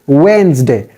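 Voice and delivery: "Wednesday" with a silent middle e; "Wednesday" in two syllables, starting with w; corrected Wednesday. Shown as a demonstration. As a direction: say "Wednesday" as wenz-day. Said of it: "E is silent here".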